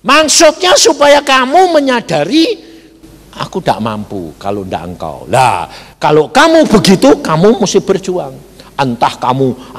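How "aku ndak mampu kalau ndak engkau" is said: low, soft voiced prayer; humble admission. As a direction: drop low and quiet, imitating a helpless admission. (menirukan pengakuan lemah, rendah dan pelan)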